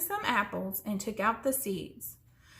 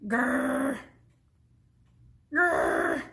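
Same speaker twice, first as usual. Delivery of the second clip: two short bear growls, a bit louder; 3 semitones higher, 4 dB louder than usual. A narrator's reading.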